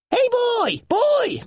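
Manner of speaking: very high, loud creature call. A narrator's reading. Nylar calling down to Finn, very high loud call. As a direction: call down to the boy from above. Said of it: "called one of the Nylars".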